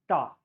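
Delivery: "ta" with the tongue moved back, retroflex; Tamil-style ta. Shown as a demonstration. Teacher showing the Tamil Nadu tongue position, retroflex ta. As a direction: say "ta" as in a Tamil accent. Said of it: "if you put your tongue instead of here, here".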